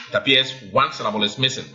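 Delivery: normal talking voice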